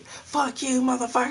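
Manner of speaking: high mock screech; hammed-up high-pitched cursing out; angry teenage girl. Teenage girl cursing at her boyfriend, mock shrill-angry yell. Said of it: "that's how they go talk to their boyfriends".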